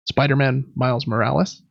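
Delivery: normal talking voice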